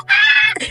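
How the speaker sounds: very high startled ah; shocked shriek. Real, not acted. Reader reacting to the twist, high shriek. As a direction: squeal in shock. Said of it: "And I was like".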